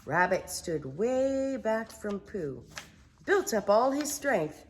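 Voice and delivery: usual speaking voice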